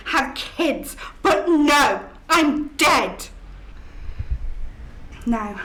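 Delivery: usual speaking voice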